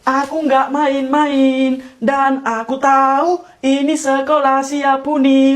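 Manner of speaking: slow, drawn-out phrasing; exaggeratedly measured denial; affected slow talk. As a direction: stretch each phrase evenly. Keeps the mocked odd delivery.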